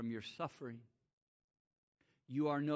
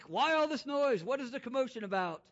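higher, louder Jesus asking; hammed-up raised voice; mock outcry; 11 semitones higher, 8 dB louder than usual. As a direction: call out over the noise. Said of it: "and he's like".

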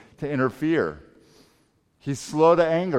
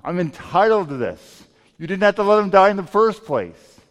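loud, indignant outburst at Jesus; higher, louder, affronted accusation; self-righteous anger; 4 semitones higher, 7 dB louder than usual. Retold or imitated. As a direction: protest as if you are entitled, pitch jumping up. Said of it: "this self-righteous anger".

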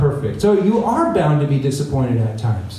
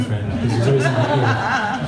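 laughing; 4 semitones lower than usual. Real, not acted. Laughing aside about podcast friend.

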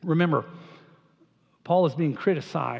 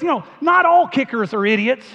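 put-on higher, louder aw-shucks Southern accent; folksy Southern accent, pitched up and loud; aw shucks Southern Peyton Manning; 7 semitones higher, 5 dB louder than usual. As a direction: play the humble Southern quarterback in a Southern accent. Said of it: "sort of talks like this".

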